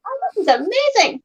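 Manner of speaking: high, hushed gushing; a lightly voiced amazed self, high and soft; raptured first bite. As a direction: swoon over the pie, breathy-free and high. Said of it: "I was like".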